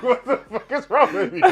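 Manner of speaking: high, loud question through laughter; laughing disbelief. Retold or imitated. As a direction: ask it while cracking up. Retold reaction, laughing hard.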